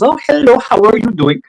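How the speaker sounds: high-pitched, smiley imitation of cute girls. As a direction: sing out a high, sweet greeting. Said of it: "they're all, like, smiles".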